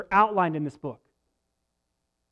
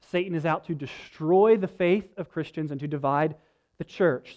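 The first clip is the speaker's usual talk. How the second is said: ordinary talk, nothing marked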